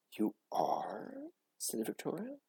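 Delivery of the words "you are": high breathy awed whisper; a stunned girl, hushed; amazed whisper. Acted. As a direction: whisper in disbelief. Victoria amazed.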